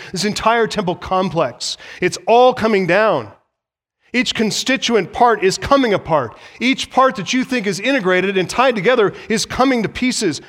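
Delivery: fiery pulpit warning that the temple falls; full-force preaching. Acted. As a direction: preach it hard to the congregation.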